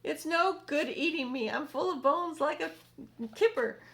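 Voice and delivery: centipede voice. Acted. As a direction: plead as the frightened centipede. Centipede voice continues.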